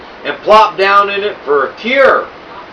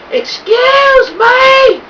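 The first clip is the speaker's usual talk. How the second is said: put-on very high, slow, quavering old woman calling up from below; sweet old lady calling; much slower than usual. A narrator's reading. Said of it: "a sweet old voice called up to us".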